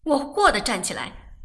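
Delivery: usual speaking voice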